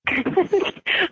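high giggling burst; burst of laughter. Real, not acted. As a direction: break into helpless laughter. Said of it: "why you laughing".

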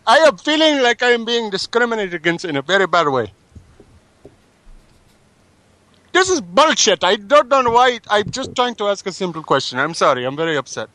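hammed-up anger at the hotel clerk, in an Indian accent; mock outrage. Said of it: "I am getting very angry right now".

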